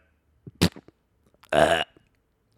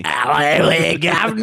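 Crocodile Dundee impression; 5 semitones lower, slower than usual. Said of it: "Crocodile Dundee".

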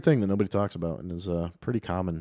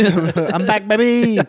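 high, loud sing-song call; sing-song greeting; 12 semitones higher, 8 dB louder, much slower than usual. Acted. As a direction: belt out a playful hello. Playful returning call.

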